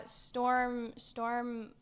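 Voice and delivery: normal talking voice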